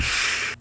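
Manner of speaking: soft, breath-only shush; quiet acted "shh". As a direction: hush someone about to speak. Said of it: "Connie shushes them".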